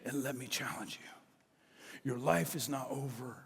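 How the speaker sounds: soft, slow whispered challenge; slow hushed voice of Jesus; gentle whisper. Acted. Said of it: "he will also say".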